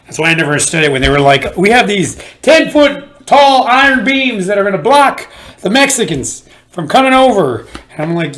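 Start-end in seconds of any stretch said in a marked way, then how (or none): 1.6-7.7 high, slow, grandiose announcing; pompous politician. Retold or imitated. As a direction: boast like a border politician.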